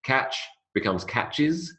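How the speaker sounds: ordinary talk, nothing marked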